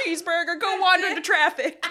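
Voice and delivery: loud, high hammed-up rant; mock angry yell. Voicing the farmer yelling at his cow, mock angry. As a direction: shout it at the cow in mock rage.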